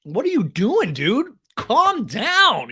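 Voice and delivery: high, loud comic protest; exasperated mock outcry. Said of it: "And I'm like".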